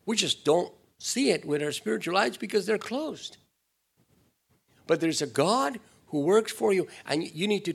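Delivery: loud, high preaching push; fiery preaching. Acted. Preacher rousing the church, loud and high. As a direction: drive it home to the congregation.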